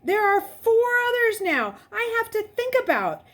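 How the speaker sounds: high, loud urgent protest; pressing, desperate. A narrator's reading. Continuing protest.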